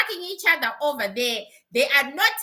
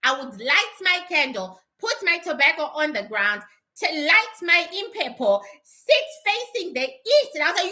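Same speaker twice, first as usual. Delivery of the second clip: ordinary talk, nothing marked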